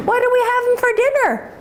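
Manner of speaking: high-pitched innocent question; little calf. Acted. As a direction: ask as an unsuspecting little calf. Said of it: "Bella the calf says".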